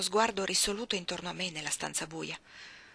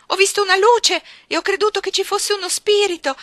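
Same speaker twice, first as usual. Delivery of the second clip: shrieked, much higher and louder; a frightened child blurting out the ghost; terrified cry; 13 semitones higher, 14 dB louder than usual. Acted. Bambina terrorizzata grida. (la bambina grida terrorizzata)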